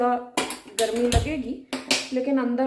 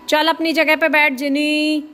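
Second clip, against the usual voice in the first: firm raised voice, higher and louder; scolding the pet; 5 semitones higher, 8 dB louder than usual. Real, not acted. Owner scolding Jini back to her spot, loud and firm. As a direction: order the dog back to its place.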